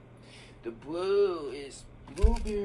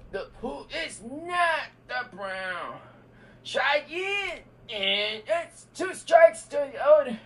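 high, loud host verdict; game-show host call; 8 semitones higher, 7 dB louder than usual. Pretend. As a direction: shout it like a quiz host. Kid acts as a game-show host.

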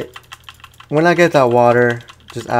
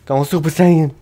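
hype; 5 semitones higher, 5 dB louder than usual. Pretend. Hyping the boss powering up.

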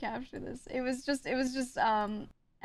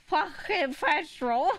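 slow, high mimicry through laughter; Apple; 8 semitones higher, 4 dB louder, slower than usual. Streamer asking what Apple was saying, mocking impression. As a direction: drag out the words in an exaggerated copy. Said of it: "what was Apple saying?".

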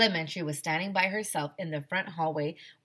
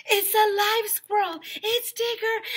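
very high, loud alarmed shout; panicked shout; 6 dB louder than usual. Acted. Andrea panicking at the live squirrel, very high loud shout. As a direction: scream the warning.

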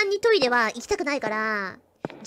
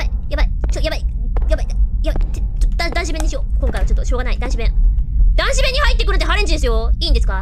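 louder, frantic yelling while fleeing; panicked shouting; 7 dB louder, much faster than usual. Real, not acted. Streamer fleeing the ghost to the men's room, panicked shouting. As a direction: blurt it out in real panic.

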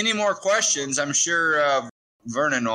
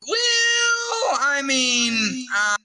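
very high, drawn-out hedging; squeaky, long-held "well"; awkward stalling; 11 semitones higher, much slower than usual. Acted. Stalling over awkward inheritance question.

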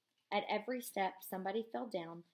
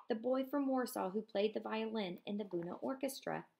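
normal talking voice

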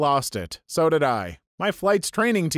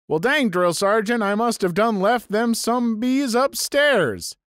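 put-on thick Tennessee accent; 5 semitones higher than usual. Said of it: "In this thick Tennessee accent".

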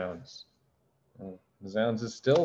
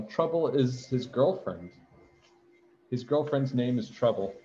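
ordinary delivery

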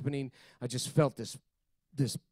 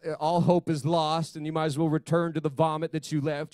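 normal talking voice

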